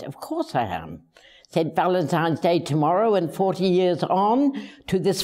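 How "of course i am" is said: low, soft, knowing comeback; quiet retort. Retold or imitated. Husband's unspoken reply to his doctor-minded wife, quiet and wry. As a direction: say it under your breath, wry. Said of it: "I should have said".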